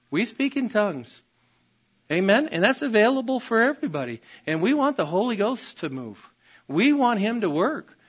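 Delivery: usual speaking voice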